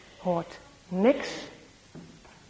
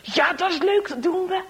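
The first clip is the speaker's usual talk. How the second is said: gleeful agreement; 8 semitones higher than usual. Acted. Boys agreeing on prank.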